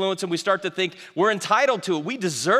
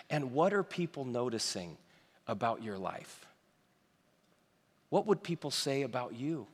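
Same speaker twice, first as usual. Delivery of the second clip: softer, intimate sermon voice; quiet earnest preaching; 4 semitones lower, 8 dB quieter than usual. Acted. Pastor softly challenges his listeners, quiet earnest preaching. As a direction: lean in and speak gently to the room.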